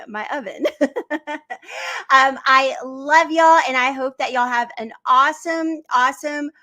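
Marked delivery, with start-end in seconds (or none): 0.7-2.0 light laugh. Real, not acted. Laughs at her onion remark. 2.1-5.8 warm sign-off. Real, not acted.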